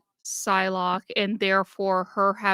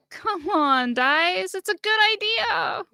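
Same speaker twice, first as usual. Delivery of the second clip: excited pleading; 11 semitones higher than usual. Real, not acted. Begging the dice for a roll.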